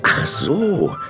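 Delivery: ordinary talk, nothing marked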